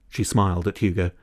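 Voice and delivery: ordinary delivery, nothing marked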